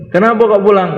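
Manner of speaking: sharp demand. Acted. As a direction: call him out, loudly. Elder demands why he goes home. (tanya keras dengan nada tinggi)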